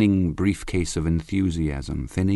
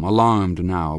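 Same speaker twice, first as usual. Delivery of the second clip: ordinary delivery, nothing marked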